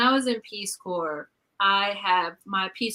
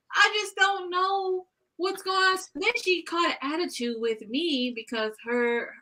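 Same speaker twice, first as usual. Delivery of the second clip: ordinary talk, nothing marked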